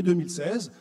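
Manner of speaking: ordinary talk, nothing marked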